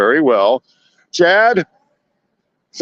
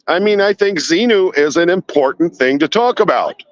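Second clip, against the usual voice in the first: ordinary talk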